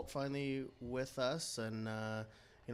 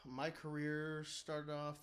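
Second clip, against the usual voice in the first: ordinary delivery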